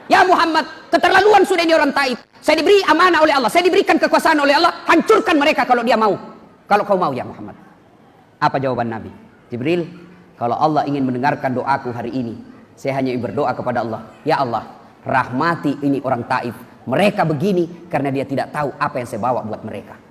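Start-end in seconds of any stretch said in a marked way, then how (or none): 0.1-2.2 high, loud outraged cry of Ya Muhammad; angry angel. Acted. Jibril angry at Taif people. 2.4-7.6 high, loud and fast, eager to strike; fierce offer. Acted. Jibril offers to destroy Taif. 9.5-20.0 gentle prayer. Acted. Prophet's gentle prayer for Taif.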